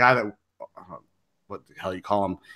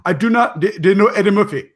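higher, louder Ugandan accent impression; 6 semitones higher, 5 dB louder than usual. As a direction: play the Ugandan guard for laughs. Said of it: "it was spot on".

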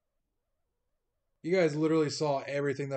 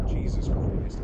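exasperated; 7 dB louder, slower than usual. Real, not acted. Exasperated at the game.

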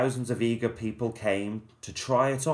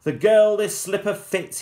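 loud, higher royal proclamation; loud pompous cry; 10 semitones higher, 7 dB louder than usual. Acted. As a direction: proclaim it to the whole town. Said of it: "he cried".

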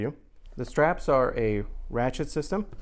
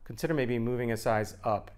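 usual speaking voice

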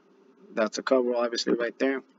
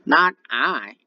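duck refusing; 4 semitones higher, 5 dB louder than usual. Acted. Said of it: "quacked the duck".

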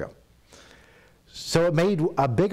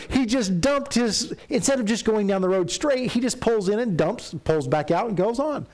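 indignant; 8 semitones higher than usual. Retold or imitated. Retelling outrage as a kid.

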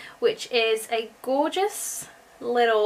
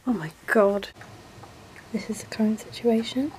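whisper. Real, not acted. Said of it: "unvoiced whisper".

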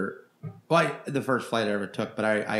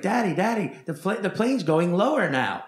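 imitating an eager kid; 6 semitones higher, 3 dB louder than usual. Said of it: "And the kid's like".

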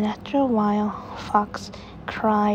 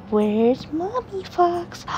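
peekaboo sing-song; 9 semitones higher, slower than usual. Acted. Peekaboo game with baby.

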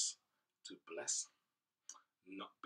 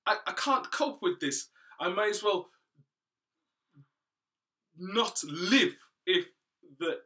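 desperate pleading; 7 semitones higher, 13 dB louder than usual. Acted. Cain despairs at his punishment.

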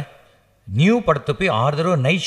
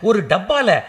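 shocked high voice; 9 semitones higher than usual. Acted. Shocked at rat poison.

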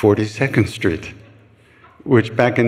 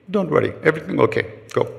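fast, higher brisk reassurance from an Indian elder; 6 semitones higher, faster than usual. Retold or imitated. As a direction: wave her off quickly, calmly. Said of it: "He said".

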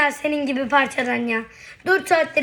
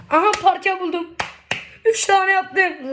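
excited; 7 semitones higher, 5 dB louder than usual. Real, not acted. Finds a puzzle piece.

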